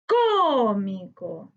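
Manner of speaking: "cómico" very slow, with a long CÓ; stressed syllable drawn out. Shown as a demonstration. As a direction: stretch the first syllable of "cómico". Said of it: "debemos buscar la sílaba tónica". ("cómico" muy lento, alargando la sílaba CÓ)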